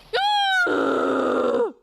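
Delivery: high screaming gasp; loud shocked gasp. Acted. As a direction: gasp loudly in horror. Accused chef breaks down.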